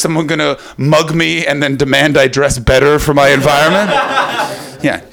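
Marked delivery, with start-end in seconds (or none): none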